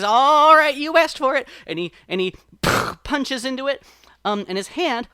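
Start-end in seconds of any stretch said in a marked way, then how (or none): 0.0-1.5 higher, louder cartoon rabbit warning; rabbit threat. A narrator's reading. Brer Rabbit warns the sap person before punching, loud. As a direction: wind up and announce the punch. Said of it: "He says".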